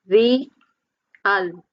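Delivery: "Re, al" as two spaced beats; syllable by syllable. Shown as a demonstration. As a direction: sound out Re, then al. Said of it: "How many?".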